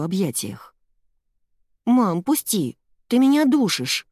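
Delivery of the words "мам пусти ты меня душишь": put-on high, strained boy's protest; protesting son. A narrator's reading. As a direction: complain while being squeezed too tight. Boy squirming in mother's hug. (протестующий мальчишеский голос)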